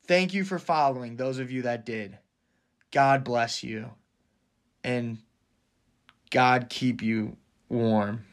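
hushed mock-sincere benediction; tender blessing. Soft blessing after the rant.

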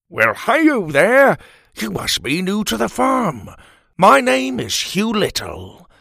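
slow, hearty hi-yo greeting; beaming country neighbour voice; folksy friendly little man. Acted. Hugh greets the cat.

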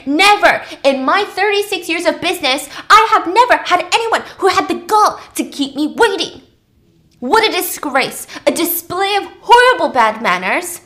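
screaming down the phone at the late client; furious yell. Acted. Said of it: "is screaming".

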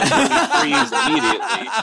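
said through laughter; laughing. Real, not acted. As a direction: laugh while you say it. Laughing at his own joke.

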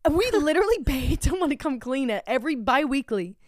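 high, loud incredulous protest; retold indignation. Wife defending her cleaning to husband, indignant retelling. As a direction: fire back in outraged disbelief.

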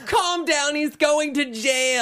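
high, drawn-out mock line reading; hammed-up high voice, stretched at the end; mocking film imitation. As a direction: parody a bad actor's overwrought plea. Mimics the clip just played.

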